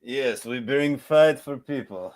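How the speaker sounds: slow, mock Russian accent. Host answers co-host's teasing in a mock Russian accent. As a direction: play along in a hammy Russian accent. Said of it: "you did your best Russian accent".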